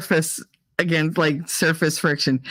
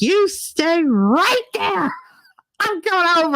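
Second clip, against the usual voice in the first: imitating furious girlfriend; 13 semitones higher than usual. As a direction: stretch each word in hammed-up outrage. Girlfriend retold angrily ordering her.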